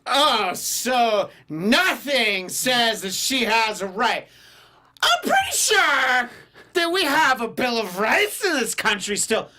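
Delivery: put-on high, slow drunk-girl drawl; drunk party girl. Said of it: "respond to that as a drunk girl from a party".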